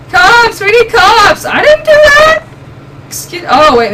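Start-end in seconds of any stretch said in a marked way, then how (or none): 0.1-2.5 high, loud denial; panicked shout. Real, not acted. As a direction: yell in rising panic. Panicking to game cops.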